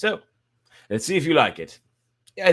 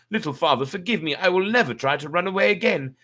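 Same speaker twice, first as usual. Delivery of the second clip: put-on higher pleading old woman; old woman begging; 8 semitones higher than usual. A narrator's reading. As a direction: beg your husband for forgiveness. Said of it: "She begged him".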